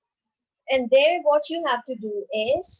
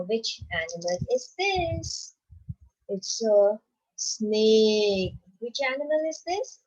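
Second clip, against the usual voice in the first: ordinary talk, nothing marked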